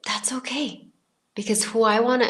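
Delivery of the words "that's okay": soft whisper, calmly brushing off the doubt; whispered reassurance. Retold or imitated. Whispering back to her doubting old self, calm and unbothered. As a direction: breathe it out as a gentle whisper.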